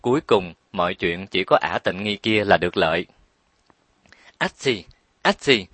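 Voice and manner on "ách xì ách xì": two mock sneezes in a row; narrator's imitated "achoo"; acted sneezing. A narrator's reading. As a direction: sneeze twice, spelled out as words. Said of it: "bị hắt hơi liên tục".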